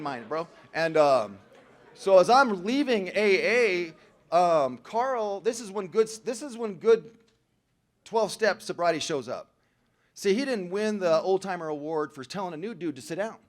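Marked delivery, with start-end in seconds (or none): none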